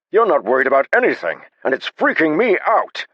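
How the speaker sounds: high, loud, frantic shouting. A narrator's reading. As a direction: yell it, freaked out. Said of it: "Despite his shouting".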